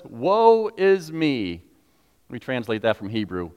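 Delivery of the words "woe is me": slow, drawn-out woe; slow mournful cry; lament. Retold or imitated. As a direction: stretch it out in dismay. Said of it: "His response was".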